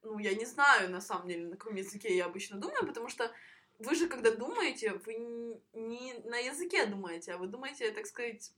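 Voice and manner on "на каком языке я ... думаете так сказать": normal talking voice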